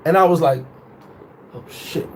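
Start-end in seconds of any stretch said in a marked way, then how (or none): 1.5-2.1 very soft, under the breath; muttered dismay. Retold or imitated. Man caught out by girlfriend, muttered dismay. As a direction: keep it to yourself, barely audible. Hushed reaction to overhearing her.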